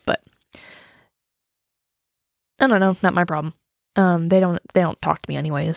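normal talking voice

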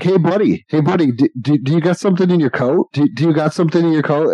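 fast, high, needling questions; pushy kid. Retold or imitated. Dave pestering the shoplifter, fast and high. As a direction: badger the thief with quick jabs.

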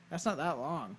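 ordinary delivery

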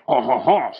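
mock-haughty French chuckle; hammed-up French "ha, ha, ha"; aristocratic French laugh. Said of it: "they are not aristocratic Frenchmen. They're not like".

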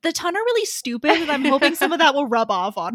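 high, fast, scheming mother voice; mock high-society mother; quoting a scheming mother. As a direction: rattle it off as the plotting mom. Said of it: "She's like".

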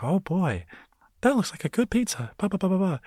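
very high, cheerful squeaky cartoon mouse; Mickey Mouse voice. A Mickey puppet admiring a pizza, squeaky and high. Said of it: "you just hear Mickey being like".

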